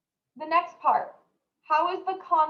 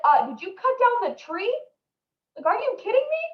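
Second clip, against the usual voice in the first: incredulous; 8 semitones higher than usual. Retold or imitated. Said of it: "So he's like".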